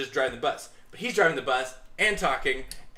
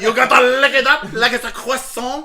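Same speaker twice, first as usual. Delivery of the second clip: put-on loud laughing French accent; over-the-top French accent; 7 semitones higher, 11 dB louder than usual. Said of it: "Definitely French".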